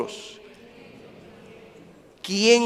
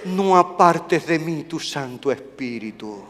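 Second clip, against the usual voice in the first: hushed prayer; 4 semitones lower, 6 dB quieter than usual. Acted. Quoting David's psalm prayer.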